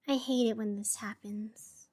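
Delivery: a hushed whisper under the breath, half to yourself; whispered aside. Real, not acted. Radio host muttering to himself about the gloomy weather, whispered. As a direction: let it slip out as a soft private whisper. Host's private aside on air.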